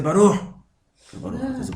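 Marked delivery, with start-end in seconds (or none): 0.0-0.3 loud, raised burst on one word; mock shouting. Rabbi mocking someone who yells in prayer, mock shout. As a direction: belt it out like an overzealous worshipper. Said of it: "¿por qué grita?".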